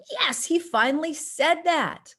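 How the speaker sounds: high, slow, delighted at last; excited relief. Retold or imitated. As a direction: cheer that he finally said it.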